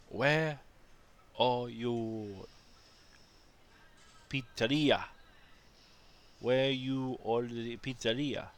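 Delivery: hammed-up Chinese-Indian accent aping a tourist; mock Chinese-Indian accent. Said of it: "tried to do, like, some Chinese and Indian sort of accent".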